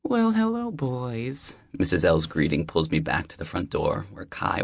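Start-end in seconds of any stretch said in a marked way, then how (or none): 0.0-1.6 high, friendly deli-lady voice; warm older neighbor. Acted. Deli owner welcoming the new neighbor, high and friendly. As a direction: greet him like an old friend at the door.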